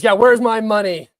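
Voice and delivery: half-joking plea for cash; mock Zelensky demand. Streamer keeps up a weak Zelensky bit asking for money. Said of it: "I'm not very good at impressions".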